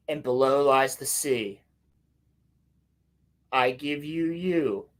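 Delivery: slow mock-ritual delivery; solemn recitation. Reviewer quotes the sisters' rhyme, slow mock recitation. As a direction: stretch the line like an incantation.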